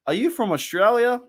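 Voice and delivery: high-pitched retold question, rising with curiosity; surprised question. Locals asking the visiting Australian where he's from, mildly surprised. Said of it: "And they went".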